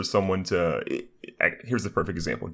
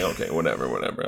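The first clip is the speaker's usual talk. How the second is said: dismissive; 6 semitones lower than usual. Retold or imitated. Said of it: "I'm like".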